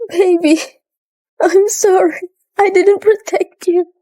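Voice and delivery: high, grief-stricken plea; anguished apology. Acted. Mother apologising to her lost baby, anguished.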